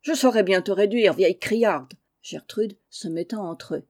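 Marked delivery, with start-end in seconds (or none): 0.0-1.9 loud quarrel with his wife; angry shouting. Acted. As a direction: bawl him down in a spat. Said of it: "criant à qui mieux mieux". (mari qui crie contre sa femme)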